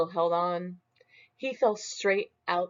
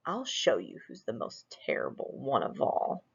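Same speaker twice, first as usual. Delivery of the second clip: lower, softer threat; low menacing vow; 5 semitones lower, 6 dB quieter than usual. Acted. Smug vows to the mirror in a low, quiet threat. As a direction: growl a quiet promise of trouble.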